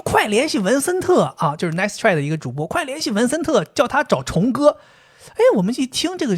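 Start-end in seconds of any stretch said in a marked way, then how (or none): 0.1-1.6 high-pitched, drawn-out mock urging; urgent commenter. Acting out an urgent online comment. 2.7-4.8 high-pitched, drawn-out mock urging; urgent commenter. Repeats the urgent online comment.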